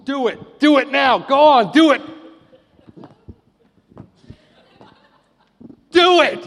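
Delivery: high, loud, escalating mock pleading; calling on their god. Preacher as prophets of Baal demanding fire, high frantic shouts. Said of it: "calling on their God".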